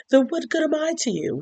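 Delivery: high, pleading voice for Colin; hurt Colin. Retold or imitated.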